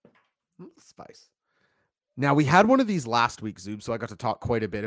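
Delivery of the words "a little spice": very soft, drawn-out whisper; slow, playful whisper; whispered echo. Real, not acted. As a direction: echo it slowly under your breath. Playful echo of co-host's phrase.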